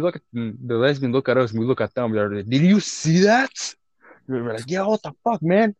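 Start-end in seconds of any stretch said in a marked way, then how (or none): 2.5-3.5 high, slow, shocked question. Retold or imitated. Retold shocked exchange at restaurant. 4.7-5.7 high outraged disbelief; shocked agreement. Retold or imitated. Retold shocked exchange at restaurant.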